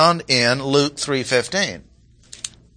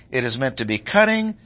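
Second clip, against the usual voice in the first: normal talking voice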